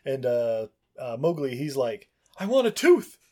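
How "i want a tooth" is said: put-on much higher, louder kid voice; insistent mock child; mock boy demanding from his mom; boy asking.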